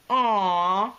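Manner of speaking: high, loud mock-tragic moan; lamenting. A narrator's reading. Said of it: "he lamented".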